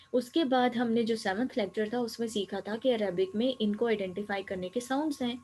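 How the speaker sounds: ordinary talk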